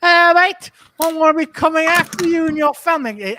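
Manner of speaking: a chirpy voice making a creepy threat; hammed-up menacing mate; mimicked creepy character. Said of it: "he goes".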